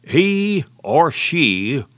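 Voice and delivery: drawn-out sneer at he or she; lightly mocking the movie star; mocking quote. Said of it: "This man said he hoped".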